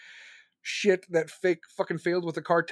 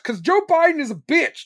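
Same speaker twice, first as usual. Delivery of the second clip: shouted punchline, higher and louder; higher, louder jab; 8 semitones higher, 6 dB louder than usual. Real, not acted. Answering why he swears, with a higher, louder jab. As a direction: spit it out as a loud punchline. Angry outburst answering question.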